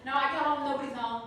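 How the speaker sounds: ordinary delivery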